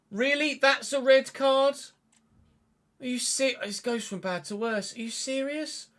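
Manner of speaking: high pitched, incredulous protest; outraged disbelief. Real, not acted. Gamer protesting a red card, outraged disbelief.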